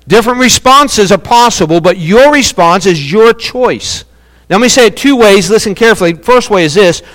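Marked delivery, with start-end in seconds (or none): none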